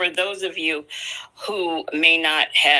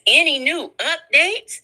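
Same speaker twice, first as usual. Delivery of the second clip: Nesto voice; 8 semitones higher than usual. Retold or imitated. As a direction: play Nesto sweet and exaggerated. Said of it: "my Nesto voice".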